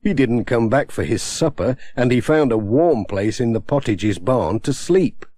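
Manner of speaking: ordinary delivery, nothing marked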